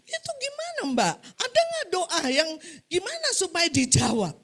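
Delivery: high-pitched imitation of tearful pleading; acted wailing old woman begging for help; crying. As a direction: plead as if sobbing, hammed up. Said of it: "Nangis-nangis".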